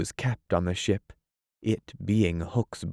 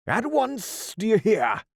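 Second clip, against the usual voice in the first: commanding cry; 14 semitones higher than usual. Acted. Peter as Hook barking orders at the pirates. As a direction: snap the order home. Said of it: "cried Peter".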